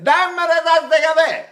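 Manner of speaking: high, loud chanted Spanish; demo delivery. Shown as a demonstration. Teacher showing tongue use in Spanish, high loud chant. Demonstrates minimal-movement articulation.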